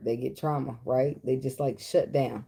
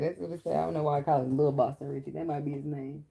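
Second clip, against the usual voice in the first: ordinary delivery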